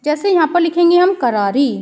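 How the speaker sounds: usual speaking voice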